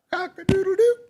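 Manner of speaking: high, restrained rooster crow; tentative crow. A narrator's reading. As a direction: try a small crow, rising at the end. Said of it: "he said softly".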